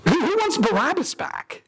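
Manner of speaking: high, disbelieving question; Pilate incredulous. Acted. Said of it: "And Pilate's saying".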